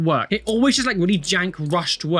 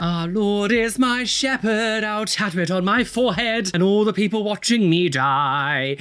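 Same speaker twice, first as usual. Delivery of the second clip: mock hymn; 4 semitones higher than usual. Mock-reading a religious tattoo text.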